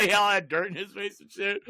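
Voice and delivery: ordinary talk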